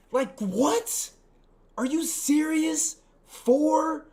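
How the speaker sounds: high, loud, drawn-out disbelief; incredulous. Real, not acted. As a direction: pitch it way up as a stunned question. Incredulous reaction to body count.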